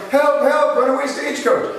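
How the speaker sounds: hammed-up damsel calling for help; mock cry for help. Salesman acting out the lady in a runaway stagecoach, hammed-up cry. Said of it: "sticking her head out going".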